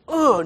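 mock pained grunt; grunt of being kicked. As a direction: grunt as though struck hard. Acting out blows Joseph takes.

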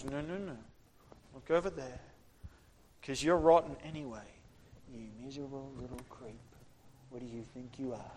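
very soft, insinuating put-down; hushed accusing devil. Acted. The devil pointing you away from the gospel, hushed and accusing. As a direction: murmur accusations close into the listener's ear. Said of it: "Starts whispering accusations in your ear".

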